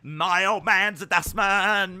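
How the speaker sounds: slow, loud Cockney British sing-song; British accent. Acted. Still doing the British accent, slow and loud Cockney. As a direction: drag out the Cockney British line. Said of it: "Do a British accent".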